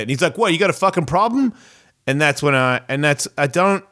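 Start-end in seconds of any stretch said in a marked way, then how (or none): 0.4-1.5 quick, higher-pitched mock challenge; retold confrontation. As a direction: imitate a drunk picking a fight, fast. Retold drunk man picks a fight.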